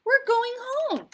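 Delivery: high, loud, upbeat dad; cheery daddy reply. A narrator's reading. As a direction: answer your toddler brightly, missing the point. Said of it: "replied her daddy".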